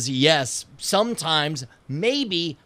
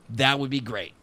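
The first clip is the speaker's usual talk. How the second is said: ordinary talk, nothing marked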